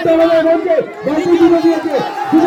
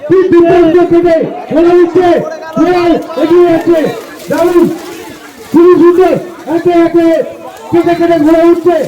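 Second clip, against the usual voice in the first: race caller; 3 semitones higher than usual. Real, not acted. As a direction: call the horse race live, building excitement. Live horse race commentary.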